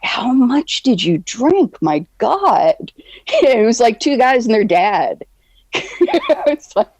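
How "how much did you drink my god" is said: incredulous. Retold or imitated. Retold disbelief at drinking.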